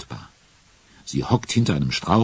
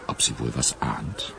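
ordinary talk

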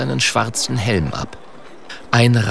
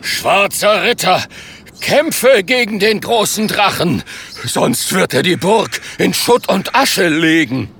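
hoher, dringlicher Befehlsruf; von oben gerufen; 7 semitones higher than usual. Acted. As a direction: rufe es vom Balkon herab. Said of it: "ruft vom Balkon herab". (dringlich und hoch herabgerufen)

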